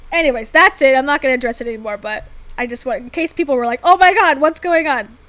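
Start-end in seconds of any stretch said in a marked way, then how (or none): 3.8-5.2 loud, higher imitation of alarmed viewers; mock alarm. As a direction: blurt it like a startled onlooker. Voices alarmed viewers in a quote.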